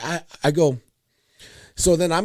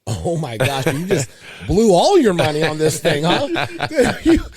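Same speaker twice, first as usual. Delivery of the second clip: laughing teasing; 6 dB louder than usual. Retold or imitated. Dad laughing at son for blowing his birthday money. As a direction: tease him through laughter. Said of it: "I'm like".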